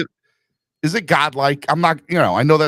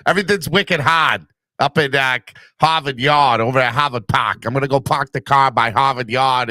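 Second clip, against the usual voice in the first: hammed-up Boston accent; mock Bostonian, dropping the r's. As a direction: play a Boston local, accent laid on thick. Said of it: "my New York accent sounded Bostonian".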